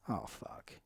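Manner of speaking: very soft, whispered dismay under the breath; whispered inner reaction. Retold or imitated. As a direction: whisper it as a retold private thought. Retelling his dismayed thought to himself.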